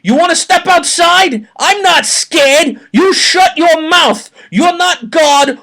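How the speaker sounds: high, loud, slowed-down tough-guy yelling; hammed-up furious challenge; mock angry shout. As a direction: bark it like a little man picking a fight. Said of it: "little Chris replied".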